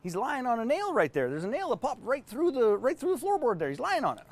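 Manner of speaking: put-on high, folksy old man explaining; drawn-up pitch, matter-of-fact old-timer; old Southern dog owner. Owner explains the nail to his friend, high folksy voice. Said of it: "And his friend says".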